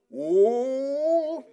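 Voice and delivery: high loud mock-surprised whoa; a parent pretending to be startled; playful parent whoa. Parent playing along with kids hiding under the bed, mock-surprised.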